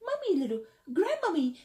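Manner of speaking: high, playful mock granny; lightly mocking little-old-lady sing-out; little grandmommy voice. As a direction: pitch it up like a tiny grandma. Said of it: "little, little voice".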